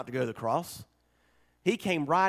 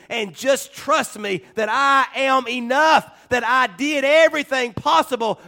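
louder, higher fervent appeal; altar call; 7 semitones higher, 7 dB louder than usual. Acted. Pastor inviting listeners to accept grace, fervent plea. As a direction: plead with them to receive it.

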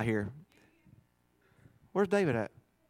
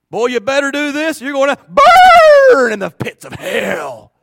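imitating a hellfire preacher, louder and slow; fire and brimstone threat; 5 semitones higher, 10 dB louder than usual. Preacher scaring a boy into salvation, loud and slow. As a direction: thunder a threat of hell at a boy.

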